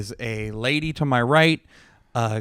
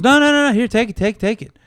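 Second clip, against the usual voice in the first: much higher and louder, insistent no no no; mock old gambler insisting, loud and high; pushy old guy; 9 semitones higher, 6 dB louder than usual. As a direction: push the chip on him, pitched way up. Old guy insists on giving money.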